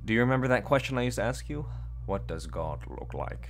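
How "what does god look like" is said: slow, hollow horror-game voice; spooky slow read. Pretend. Reading the game's eerie question, slow and hollow. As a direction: drag the words out like a creepy narrator. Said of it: "horror maxing".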